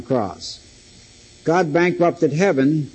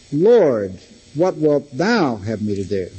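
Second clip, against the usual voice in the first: "Lord", pause, then "thou" lifted and stretched; stress on thou; slower than usual. Shown as a demonstration. Preacher showing whose will matters, stress on "thou". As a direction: lean on "thou", let "have me to do" fall away. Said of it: "an important place to put the emphasis".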